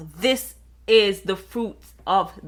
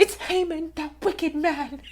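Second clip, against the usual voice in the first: dramatic queen; 7 semitones higher, 5 dB quieter than usual. Retold or imitated. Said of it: "She's like".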